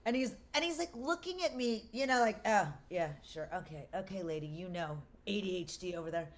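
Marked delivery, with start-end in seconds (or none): 2.5-6.3 low, soft, dismissive aside; judgy doctor. Retold or imitated. Doctor doubting a patient who says they're sensitive, judgy dismissal. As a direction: humour them with a judgy undertone. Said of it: "he was, like, all judgy on me".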